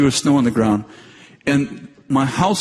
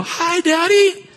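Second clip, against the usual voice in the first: put-on high, slow child voice; sweet sing-song hi from the children; little kids' greeting; much slower than usual. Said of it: "they go".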